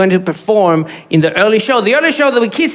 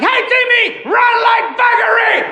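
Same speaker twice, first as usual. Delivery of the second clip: put-on high, shouted call to a kid across the field; parent yelling; 12 semitones higher than usual. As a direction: yell it like a footy parent on the sideline. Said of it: "yelled by the parents to their children".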